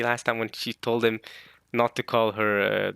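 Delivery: usual speaking voice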